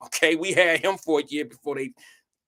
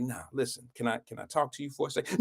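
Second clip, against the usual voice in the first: soft, low request; whispered over; 4 semitones lower, 9 dB quieter, faster than usual. Retold or imitated. Boy asking an angry classmate to talk aside, soft and low. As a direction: quietly ask for a private word. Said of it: "I just whispered over to her".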